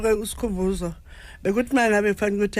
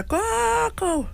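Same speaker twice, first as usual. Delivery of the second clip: high, drawn-out call for granny; imitating child calling; 14 semitones higher than usual. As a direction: call out to Gogo like a child, pitched way up.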